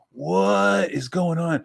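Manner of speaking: put-on confused kid. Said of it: "I was just like".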